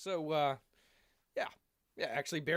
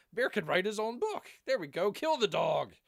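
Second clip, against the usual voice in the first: high, loud proclamation; mock triumph; 9 semitones higher, 9 dB louder than usual. Bear pitching a screenwriting book of its own, mock triumph. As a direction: announce it grandly at full voice.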